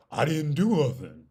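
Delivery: hammed-up innocent outrage; mock defiant denial. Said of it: "on that whole".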